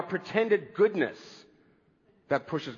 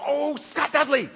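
higher, red-faced exclamation, a dubbed swear; mock movie curse; 7 semitones higher than usual. Movie character cursing with a dubbed-over swear, mock outburst. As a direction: blurt it out like a cleaned-up curse. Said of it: "get red in the face and go".

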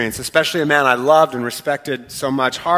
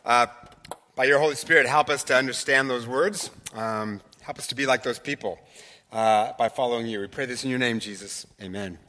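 soft, hushed prayer voice, trailing off; closing prayer; 6 semitones lower, 9 dB quieter than usual. Acted. Pastor closing prayer to Jesus, hushed and soft. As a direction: pray quietly to close.

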